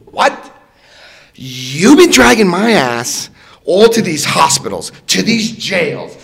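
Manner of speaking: loud, slow, aggrieved protest; hammed-up outburst at the sponsor; retelling his angry complaint to the sponsor, loud and slow; indignant rant. Ranting back at sponsor.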